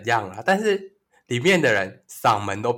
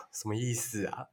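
sighing, very soft; 14 dB quieter than usual. Retold or imitated. Retold unimpressed reaction.

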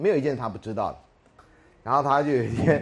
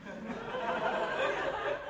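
high breathy laugh; 9 dB quieter than usual. Real, not acted. Reacting to student's cheeky question.

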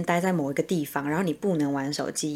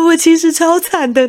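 mock sob; 10 semitones higher, 10 dB louder than usual. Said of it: "然后开始狂哭".

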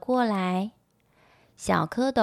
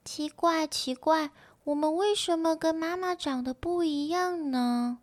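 high little voice, wondering; puzzled tadpoles; 7 semitones higher, 3 dB quieter than usual. A narrator's reading. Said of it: "一起疑惑地摇摇尾巴说".

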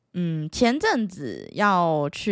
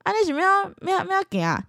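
taxi driver voice; 11 semitones higher than usual. Retold or imitated. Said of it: "然后他就说".

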